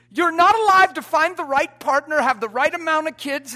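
loud, driving preacher's build; full-force preaching. Acted. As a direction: preach it hard to the back row.

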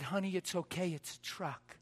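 soft "it's okay" over a wrecked truck; soothing reassurance. Retold or imitated. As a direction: hush it like it truly doesn't matter. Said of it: "You know how we say that stuff?".

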